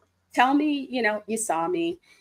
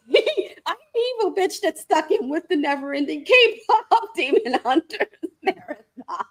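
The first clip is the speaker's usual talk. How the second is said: laughing; 11 semitones higher, 6 dB louder than usual. Real, not acted. Laughing at her own joke.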